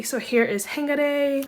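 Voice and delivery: ordinary talk, nothing marked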